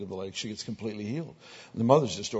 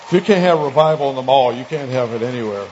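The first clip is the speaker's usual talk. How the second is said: much louder, ringing preacher's call; full-force preaching punchline; 4 semitones higher, 15 dB louder than usual. Real, not acted. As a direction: drive the point home to the crowd. Preacher building to a point.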